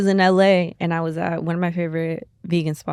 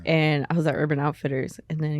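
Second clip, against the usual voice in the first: ordinary talk, nothing marked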